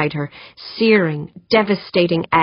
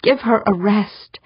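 higher-pitched plea for mercy; pleading; 5 semitones higher than usual. A narrator's reading. Prisoner begging torturers to pause, pleading. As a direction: beg for a let-up. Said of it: "Eberly pleaded".

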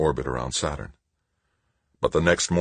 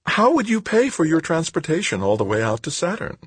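very high, condescending schoolteacher question; patronizing schoolteacher; 12 semitones higher than usual. A narrator's reading. Woman asking Tavalera how he'd pay, patronizing schoolteacher. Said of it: "back to her patronizing schoolteacher tone".

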